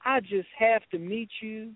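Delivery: high-pitched pleading beauty queen, mimicked; imitated Southern belle. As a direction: play a smitten Southern caller begging to meet. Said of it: "Kim would call me up and go".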